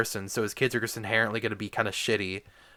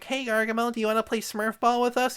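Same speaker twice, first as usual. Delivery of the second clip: much higher, sing-song mock Smurf invite; cheery Smurf voice; 10 semitones higher than usual. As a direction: play a sugary little Smurf asking to play. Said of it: "they're like".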